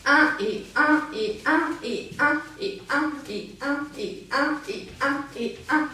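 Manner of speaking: continuous counting with "et" between beats; rapid rhythmic count. Shown as a demonstration. Dance teacher demonstrating quick weight changes, rapid count. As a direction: chant a driving, unbroken beat. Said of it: "une question de rapidité d'échange".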